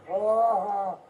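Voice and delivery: real loud groan of pain; a loud pained moan; real anguished groan. Real, not acted. Restrained man groaning.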